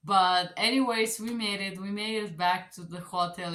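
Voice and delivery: usual speaking voice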